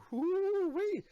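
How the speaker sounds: high whooping cry; excited whoop. Retold or imitated. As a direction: let out a delighted whoop. Acting out his own reaction.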